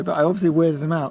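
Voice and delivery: ordinary talk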